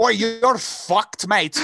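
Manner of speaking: hammed-up, drawn-out terrible Scottish accent; bad Scottish accent. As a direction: botch a Scottish accent that drifts toward Australian. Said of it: "That was a terrible accent".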